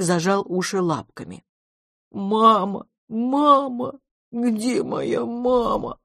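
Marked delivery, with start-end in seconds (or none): 2.1-6.0 put-on high, drawn-out wail of a lost child monster; acted tearful calling for mama; sobbing monster. A narrator's reading. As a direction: wail it higher and stretch the last words. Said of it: "рыдало оно".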